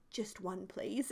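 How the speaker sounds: hushed, unvoiced whisper; whispered plea. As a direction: whisper it so nobody hears. Whispered request to grandma.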